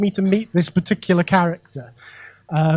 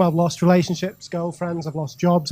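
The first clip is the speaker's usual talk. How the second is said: usual speaking voice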